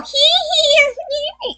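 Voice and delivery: very high, put-on "hee hee" squeal; mock girly giggle. Mocking fake feminine traits nobody has, high put-on giggle. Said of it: "Nobody runs around all".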